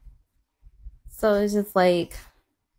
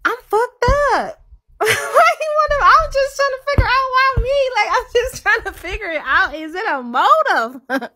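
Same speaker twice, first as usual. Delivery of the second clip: very high squealed venting, near laughing and crying; overwhelmed disbelief; 14 semitones higher, 4 dB louder than usual. Retold or imitated. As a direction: squeal it out as if about to break up laughing.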